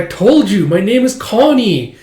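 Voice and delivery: put-on high, exasperated girl's voice; exasperated reminder. Forgotten classmate corrects Anya, exasperated.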